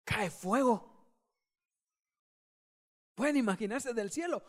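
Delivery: ordinary delivery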